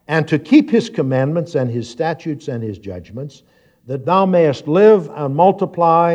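declaimed Bible reading with swelling phrases; scripture reading. Acted. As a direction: proclaim the verse to the congregation. Reads scripture aloud to congregation.

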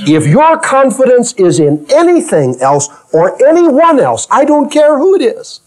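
high rising preacher's call; full-force preaching; fiery preaching. Acted. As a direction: press it home to the congregation. Preaching climax.

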